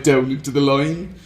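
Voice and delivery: ordinary talk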